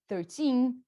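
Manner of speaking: slow thirteen with a long, strong second syllable; stress on TEEN. Shown as a demonstration. Teacher showing the strong syllable, thirteen stressed on teen. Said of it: "o teen é o cara forte".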